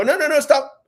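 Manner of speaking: high-pitched, acted-out objection; urgent interjection. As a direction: cut in to stop someone, pitch jumping up. Father interrupting a stranger, retold.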